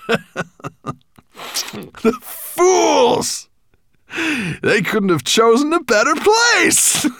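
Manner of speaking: high, loud laughing through the words; chortling dragon. Acted. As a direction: cackle with glee between the lines.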